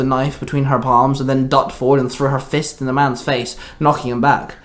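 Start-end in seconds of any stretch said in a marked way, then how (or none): none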